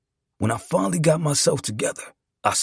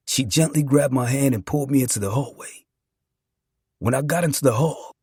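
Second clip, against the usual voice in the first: usual speaking voice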